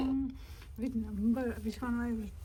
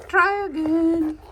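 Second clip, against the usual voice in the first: loud, higher command; loud call; 4 semitones higher, 11 dB louder than usual. Real, not acted. Kid loudly urging the others to try again. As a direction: call it across the table.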